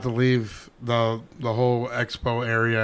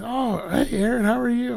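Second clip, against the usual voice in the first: imitated friendly greeting; 9 semitones higher than usual. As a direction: greet him as the famous coach, voice lifted. Acts out coach greeting him.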